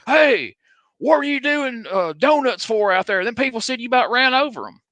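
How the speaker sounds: high, scolding Southern cop holler. Retold or imitated. Cop hollers at the driver about running people over, high scolding call. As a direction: yell it like a riled-up cop.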